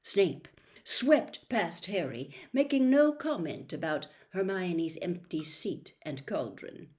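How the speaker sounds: usual speaking voice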